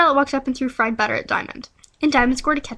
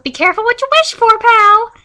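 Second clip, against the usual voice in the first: loud high warning shout; yelling; 9 semitones higher, 9 dB louder than usual. Acted. Charlie running up to Robert, loud high shout. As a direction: bellow it at him. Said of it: "Charlie ran up to Robert and yelled".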